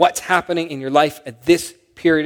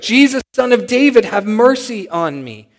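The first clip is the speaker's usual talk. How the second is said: high, loud imitated cry for mercy; retold shout; 6 semitones higher, 5 dB louder than usual. Blind beggar calling to Jesus over the crowd, retold shout. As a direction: call out like the beggar straining to be heard. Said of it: "he began to shout".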